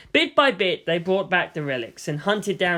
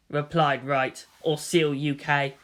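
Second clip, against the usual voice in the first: usual speaking voice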